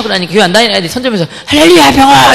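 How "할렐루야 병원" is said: high, loud cheer. Retold or imitated. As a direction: cheer like a crowd. Classmates cheering.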